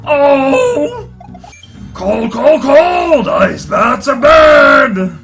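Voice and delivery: freezing yelps. Acted. Bowser freezing in ice bath.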